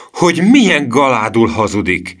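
high, loud outrage; angry cry. A narrator's reading. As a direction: exclaim it in indignation. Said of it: "kiáltotta Emil dühösen".

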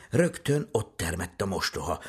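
ordinary delivery

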